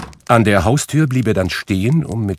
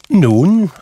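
cheerful; 11 semitones higher, 7 dB louder than usual. A narrator's reading. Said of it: "antwortete der Neffe heiter".

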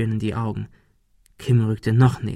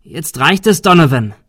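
loud, raised demand; fed-up shout; 5 semitones higher, 7 dB louder than usual. A narrator's reading. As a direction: call him out sharply. Confronting the gunman, shouted.

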